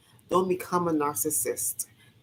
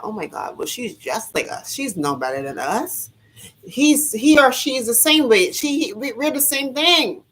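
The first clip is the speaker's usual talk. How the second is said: higher, louder mock voice of the narcissists; 7 semitones higher, 5 dB louder than usual. Imitating narcissists claiming she's no better, high mock voice. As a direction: sneer it as they would say it. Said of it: "They wanna prove to the world".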